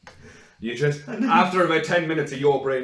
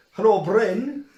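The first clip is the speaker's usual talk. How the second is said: bright greeting, higher and louder; calling Bren; 7 semitones higher, 7 dB louder, slower than usual. Pretend. On a pretend phone call to Bren, hearty raised greeting. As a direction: greet Bren as if ringing him up.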